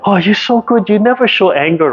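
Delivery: high, gushing mock compliment; mock praise. Teacher mimicking people praising a calm student, gushing. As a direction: lay on the fake admiration up high. Mocking how others praise calmness.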